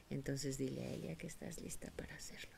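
whispered instruction. Real, not acted. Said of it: "therapist whispers instruction".

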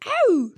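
loud, high howl; high shouted "ow"; Mortis shout. Acted. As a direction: yelp loudly in a high voice. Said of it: "Ne bağırıyorsun Mortis?".

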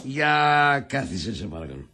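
low, slow, measured request; ominous politeness. Acted. Host sitting the visitor down for questioning, ominously calm.

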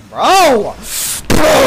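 furious shout. Real, not acted. Gamer yelling at his teammate mid-match, furious high shout. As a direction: yell it high and loud, fed up.

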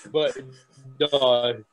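usual speaking voice

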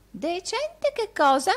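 very high, slow querulous question; sharp old housekeeper. A narrator's reading. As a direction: demand an answer in an old woman's voice. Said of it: "disse Margherita vivamente".

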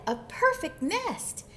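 high-pitched storybook exclamation; thrilled chicken voice, swooping pitch; excited cry. A narrator's reading. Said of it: "she cried".

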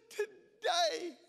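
lightly voiced weeping old man; old volunteer telling the preacher, tearful; blubbering old Englishman. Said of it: "in his strong English accent and his blubbering mess".

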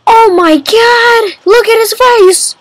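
high excited squeal; delighted shock. Real, not acted. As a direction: gush over the king's face. Gasps at the king's entrance.

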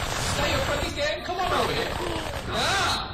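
high-pitched teasing taunt at the other player; mocking taunt. Real, not acted. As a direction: needle him, sing-song and high. Taunting an opponent in game.

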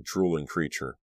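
usual speaking voice

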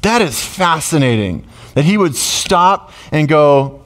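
usual speaking voice

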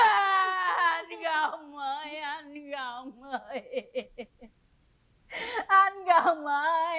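long drawn-out sobbing call; mourning wail. Acted. Wife calling her dead husband, wailing. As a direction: wail the name slowly, swelling and sinking.

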